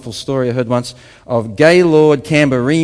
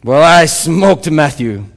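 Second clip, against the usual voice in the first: put-on slow African accent, building louder and higher; 5 semitones higher, 6 dB louder, slower than usual. Convert confessing to the conference, building African-accented oratory. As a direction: climb to a high, loud African-accented peak. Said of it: "in obviously African accent".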